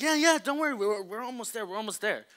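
high, bright hurry-along reassurance; mock reassuring friends. Friends telling Isaac not to worry, chirpy. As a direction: brush off his worry, keep him going.

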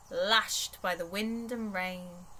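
ordinary delivery

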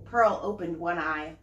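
put-on lively storybook reading for kids; story narration. A narrator's reading. Mom reading a picture book to her child, animated. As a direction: read it out like bedtime to little ones.

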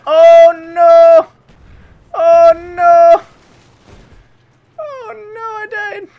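loud high shriek of dismay; panicked shriek. Real, not acted. Streamer losing his streak, shrieking in panic. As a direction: shriek as the character dies. Dying in the game.

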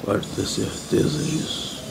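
slow, near-whispered assurance; hushed aside. Pretend. Smoker after reading the cigarette pack, hushed aside. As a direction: drop right down and draw it out softly. Answers the pack's warning, hushed.

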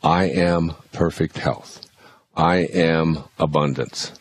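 slow, deliberate affirmations. Shown as a demonstration. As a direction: say each affirmation slowly. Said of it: "For example".

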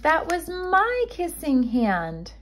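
put-on high, slow, heartbroken child voice; saddest voice. A narrator's reading. Chester telling his mother, in his saddest voice. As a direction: voice it like a little raccoon about to cry. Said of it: "in the saddest voice his mother had ever heard".

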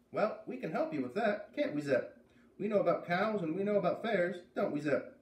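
put-on high, bright girl calling out; high-pitched girl calling across the yard; voiced farm girl calling. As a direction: call out as a friendly farm girl. Beat offers help.

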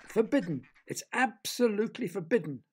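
furious scolding. A narrator's reading. Charlie jumping up and down at children, furious. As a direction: scold with heavy emphasis. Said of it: "waving his arm in the air furiously".

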